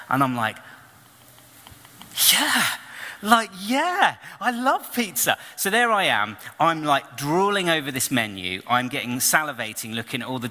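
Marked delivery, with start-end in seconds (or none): 2.3-5.4 high, eager "yeah, I love pizza"; eager yes. Retold or imitated. Answering the pizza promoter, high and eager. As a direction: jump at the offer like a kid. Accepting the free pizza offer.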